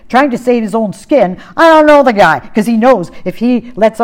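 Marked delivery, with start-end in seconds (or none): 1.6-2.4 louder, higher heated denial; swearing denial. Retold or imitated. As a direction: snap it out to save his own skin. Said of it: "He's cursing and swearing".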